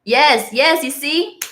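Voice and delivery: ordinary talk, nothing marked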